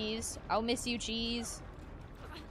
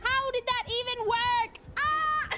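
much higher, amazed; disbelief; 9 semitones higher than usual. Real, not acted. Baffled by the glitchy death, amazed. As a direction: squeal in disbelief.

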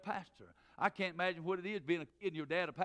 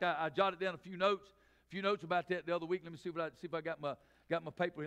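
ordinary talk, nothing marked

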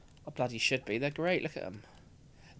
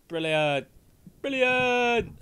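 mock child voice; 7 semitones higher, 7 dB louder, slower than usual. Mimicking the child's voice.

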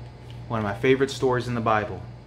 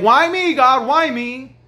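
higher, louder mock whine of a self-pitying man; self-pitying plea; 12 semitones higher, 8 dB louder, slower than usual. Preacher voicing a self-pitying man, mock whine. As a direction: cry out why me like a sulking victim. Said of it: "This man wasn't saying".

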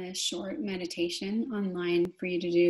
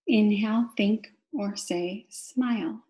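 guided meditation. Real, not acted. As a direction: draw out each cue word, pausing for the breath. Guides breathing with key words.

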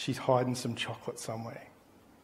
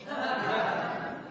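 soft laugh; 5 semitones higher, 8 dB quieter than usual. Real, not acted. Laughs at own excuse.

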